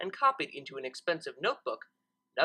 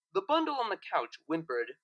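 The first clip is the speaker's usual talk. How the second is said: plain reading voice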